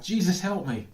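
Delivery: loud, high plea for help; a sudden loud, high call for help; crying out. Retold or imitated. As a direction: cry out to Jesus like someone drowning. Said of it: "as they cry out".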